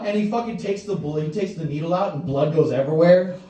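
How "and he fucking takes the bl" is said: ordinary talk, nothing marked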